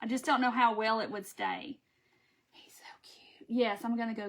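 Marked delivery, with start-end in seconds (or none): 2.5-3.5 breathy little whisper. Real, not acted. Crafter whispering fondly to viewers about her snowman. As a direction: whisper it like a secret.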